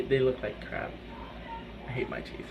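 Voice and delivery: whispered confession, almost silent. Real, not acted. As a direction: breathe it out like a secret. Complaining about teeth.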